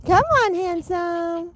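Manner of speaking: high sing-song call; calling pets. Real, not acted. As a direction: coax them in a high voice.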